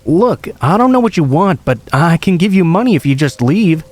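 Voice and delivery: high-pitched frightened pleading; shaking voice. A narrator's reading. Victim offering intruders money to leave, shaking voice. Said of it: "he just laughed at my shaking voice".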